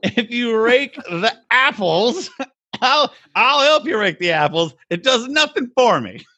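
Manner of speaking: high, slow laughing wife impression; high mock wife voice. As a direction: play the wife, giggling and dismissive. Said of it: "And she's like".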